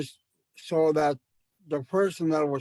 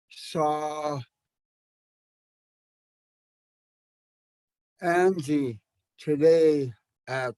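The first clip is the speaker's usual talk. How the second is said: slow, spaced-out Oh I saw Angie today at Walmart; much slower than usual. Shown as a demonstration. Teacher showing slow speech at your own speed. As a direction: take your time, pausing between words. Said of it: "you could say that slow".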